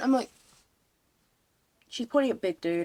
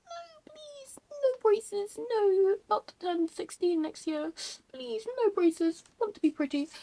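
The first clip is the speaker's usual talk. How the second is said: high, whiny hammed-up begging; mock pleading; 12 semitones higher, 3 dB louder, slower than usual. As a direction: beg in a squeaky fake-crying voice. Retold plea against braces.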